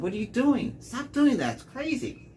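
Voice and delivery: high alarmed yelling at her; urgent high-pitched protest to passenger; retold alarm. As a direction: shout at her to stop. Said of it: "I said".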